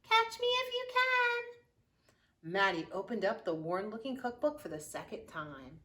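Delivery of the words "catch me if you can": very high, slow singsong taunt; taunting shout. Acted. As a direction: stretch it out like a teasing chant. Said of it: "the gingerbread baby shouting".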